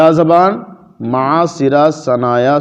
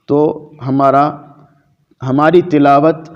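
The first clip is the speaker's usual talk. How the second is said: usual speaking voice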